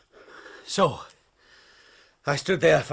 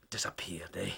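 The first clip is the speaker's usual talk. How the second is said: hushed whisper; 4 semitones lower, 11 dB quieter than usual. Acted. Old sailor hinting at murder, whispered slyly. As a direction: breathe the word, sly and hushed. Sinister hint about vanished man.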